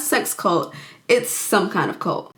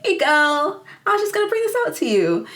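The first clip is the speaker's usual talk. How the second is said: high, fast, sugary cover-up voice, much higher; sugary fake-nice friend; 7 semitones higher than usual. Friend covering for herself, overly sweet high chirp. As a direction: chirp it brightly like nothing happened.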